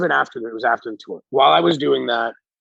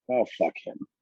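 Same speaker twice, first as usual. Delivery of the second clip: dismissive scoff; 5 semitones lower, 9 dB quieter than usual. Retold or imitated. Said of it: "My attitude is almost like".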